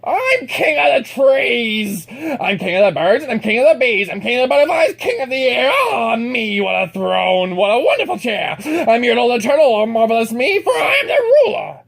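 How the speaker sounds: gloating turtle king. Acted. Yertle crows over trees, birds and bees, loud. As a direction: revel in your height.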